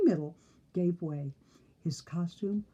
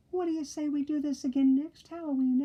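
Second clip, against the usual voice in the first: high piggy voice; 9 semitones higher, faster than usual. Acted. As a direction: squeal it like a happy pig. Pig character voiced high.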